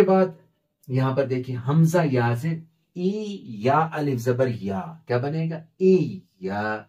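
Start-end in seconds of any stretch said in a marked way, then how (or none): none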